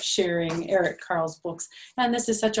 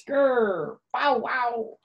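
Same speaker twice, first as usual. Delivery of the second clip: put-on playful growl then barking for kids; be the dog for the children; mock dog sounds, higher; 5 semitones higher, 4 dB louder, much slower than usual. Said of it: "He says".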